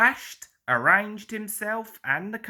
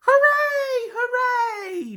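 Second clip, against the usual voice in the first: much higher, louder joyful cheer; cheering engines; 5 dB louder, slower than usual. A narrator's reading. The engines cheer the trip to England, high loud cheer. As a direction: cheer like whistles blowing. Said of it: "the engines whistled".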